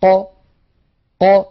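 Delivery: ق with the articulators parted, slow release; separated qaf. Shown as a demonstration. Tajweed teacher demonstrating ق slowly to students. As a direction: let ق open gently, not clash. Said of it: "بدلا من ذلك تخرج هكذا".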